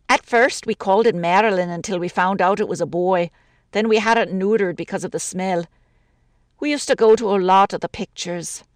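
lightly voiced Irish widow, chatty grumble; a lonely woman complaining about the city; a hint of an older woman's voice, gently aggrieved. Woman telling about the cat's name.

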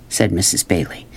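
plain reading voice

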